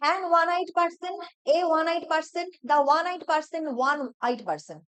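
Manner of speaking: each option read slowly. Shown as a demonstration. Teacher reading answer choices to the class. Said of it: "Which is the correct sentence?".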